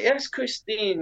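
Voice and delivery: high-pitched imitation of a cheering welcome; excited greeting. Skaters welcoming his mum, in a high excited greeting. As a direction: greet her high, like a hyped friend.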